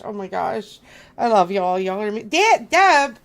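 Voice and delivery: usual speaking voice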